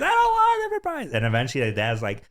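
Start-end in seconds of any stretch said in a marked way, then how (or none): 0.0-1.1 hammed-up childish tantrum whine; crying kid. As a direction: imitate a kid crying over a toy. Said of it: "his kid's crying".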